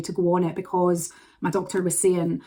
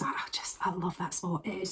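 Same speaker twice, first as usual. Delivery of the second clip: soft, almost whispered, much softer; hushed awe; 12 dB quieter than usual. Real, not acted. Recalling the Three Sisters to viewers, hushed awe. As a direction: breathe it out in quiet wonder.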